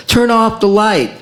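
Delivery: high mock yell. Venus yelling at the poet, high mock yell. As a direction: call it out up high. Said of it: "strangles a comet tail and yells".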